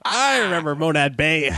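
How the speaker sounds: put-on an old man chuckling, reminiscing. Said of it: "male one".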